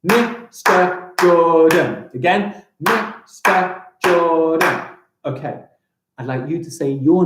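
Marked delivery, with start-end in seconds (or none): 0.0-2.1 very slow "Mister Jordan", gap after every syllable; four separate, even beats on "Mister Jordan"; clapped-out syllables. Shown as a demonstration. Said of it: "clap out the syllables to my name". 2.8-4.8 very slow "Mister Jordan", gap after every syllable; four separate, even beats on "Mister Jordan"; clapped-out syllables. Shown as a demonstration. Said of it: "clap out the syllables to my name".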